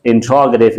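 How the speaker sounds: "interrogative" with stress on the second syllable, -ROG-; standard pronunciation. Shown as a demonstration. Teacher showing how to pronounce the word, "interrogative" as in-TROG-uh-tiv. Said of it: "how to pronounce the word".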